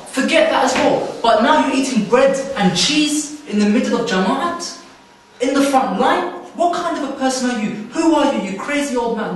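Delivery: high, loud furious rant; angry raving. Retold or imitated. Displaced man rants loudly at the old man, furious. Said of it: "the first voice you hear".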